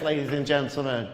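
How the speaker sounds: usual speaking voice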